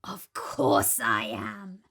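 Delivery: low, slow crocodile growl; menacing crocodile. Acted. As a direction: relish it, deep and slow. Voiced crocodile.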